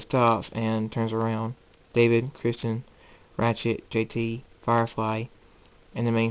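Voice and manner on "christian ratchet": plain reading voice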